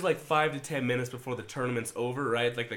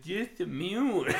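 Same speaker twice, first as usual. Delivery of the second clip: higher, drawn-out mimicry; mocking kid impression; 5 semitones higher, slower than usual. Retelling the kid's bait answer, slow high mockery. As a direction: stretch it out in a silly kid voice.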